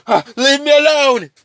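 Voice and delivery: high, loud fleeing cry; acted scream to be left alone; panicked yell. Villains flee from Carnage.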